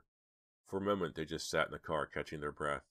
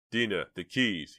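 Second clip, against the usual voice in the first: loud, slow, raised call; louder and higher, drawn out; shouted demand; 4 semitones higher, 7 dB louder, slower than usual. A narrator's reading. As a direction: shout for them urgently. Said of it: "he shouted".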